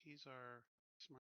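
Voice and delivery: ordinary talk, nothing marked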